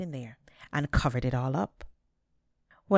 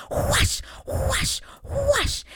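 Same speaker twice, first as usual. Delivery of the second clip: beating noises; 6 dB louder, much slower than usual. A narrator's reading. Beating the tree with stick.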